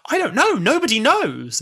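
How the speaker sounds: high, loud insistence; acted Doctor denying loudly; emphatic Doctor. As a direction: blurt it out to shut the question down. Doctor lying to Amy, retold.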